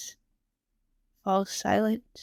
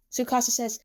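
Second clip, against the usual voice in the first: usual speaking voice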